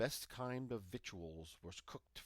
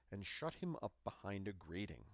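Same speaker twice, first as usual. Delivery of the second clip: usual speaking voice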